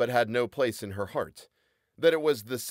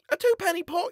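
put-on high boyish voice; a lively lad answering; young boy character voice; Tobias, boyish voice; 3 dB louder than usual. A narrator's reading. Tobias ordering.

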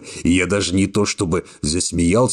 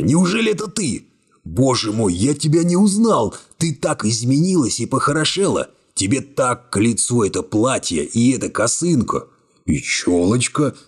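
excited reunion; 6 semitones higher than usual. A narrator's reading. Narrator voices excited hero.

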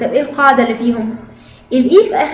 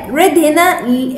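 ordinary talk

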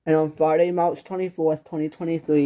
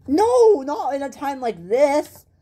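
panicked outburst, slowing down; frantic refusal; 8 semitones higher, slower than usual. Acted. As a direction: cut her off in panic. Distraught husband refuses to leave.

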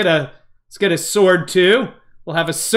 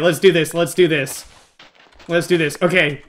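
usual speaking voice

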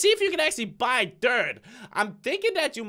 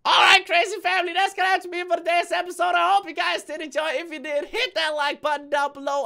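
loud, higher upbeat sign-off; YouTube outro; 6 semitones higher, 4 dB louder than usual. Acted. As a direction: hype up the outro. Channel outro.

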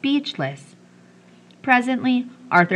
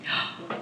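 sharp breathless gasp; 11 dB quieter than usual. A narrator's reading. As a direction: gasp in surprise. Children react to gold.

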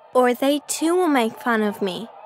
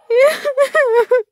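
choked sob; 11 semitones higher, 5 dB louder than usual. Acted. Duckling despairs, wishes unborn.

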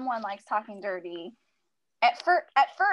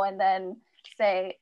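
usual speaking voice

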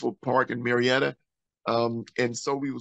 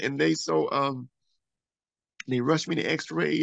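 ordinary talk, nothing marked

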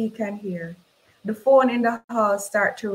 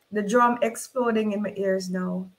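plain reading voice

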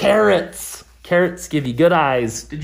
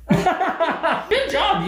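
laughing; 10 semitones higher than usual. Real, not acted. Praise during eye test.

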